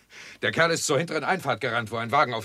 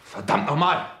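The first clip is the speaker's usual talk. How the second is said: lauter, gereizter Ausruf; wütender Fluch; 5 semitones higher, slower than usual. Acted. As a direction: flucht hörbar verärgert über den Angreifer. Schüsse in der Nacht. (Inspektor flucht nach den Schüssen wütend laut)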